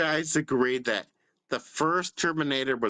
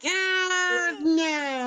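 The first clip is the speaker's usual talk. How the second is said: mocking friend; 13 semitones higher than usual. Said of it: "the way you're like".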